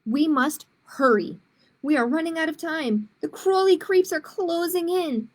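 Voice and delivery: put-on reading to children, clear and lively; picture book read aloud; story narration. A narrator's reading. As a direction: tell it like storytime for kids.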